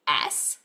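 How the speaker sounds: a long hissed "S" like a snake; s sound. Shown as a demonstration. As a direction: hiss out "S". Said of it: "The S makes a s sound".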